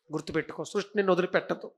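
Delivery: normal talking voice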